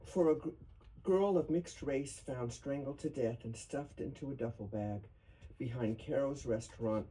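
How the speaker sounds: grave, measured poem recital; solemn reading. Acted. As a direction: read it like an elegy at a memorial. Reads elegy poem aloud.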